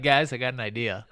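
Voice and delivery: mock French accent, hammed up; playful French-accented Macron impression. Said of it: "you said it in a French accent".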